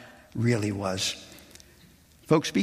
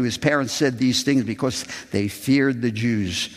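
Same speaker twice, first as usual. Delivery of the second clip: ordinary talk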